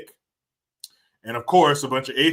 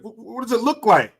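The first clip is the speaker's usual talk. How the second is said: higher, louder sarcastic snap; mock annoyed retort; 9 semitones higher, 6 dB louder than usual. Man snapping at a pointless question, mock annoyed. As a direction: toss it back, mock irritated. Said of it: "it's just annoying".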